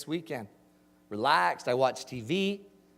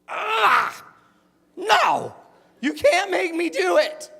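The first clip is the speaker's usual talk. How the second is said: put-on much higher and louder, whining like a kid refusing; crying-voiced teen pleading against math; whiny kid protest; 12 semitones higher, 7 dB louder, much slower than usual. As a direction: wail it like a teenager who won't do homework. Acting his teen self's protest.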